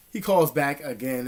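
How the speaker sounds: ordinary talk, nothing marked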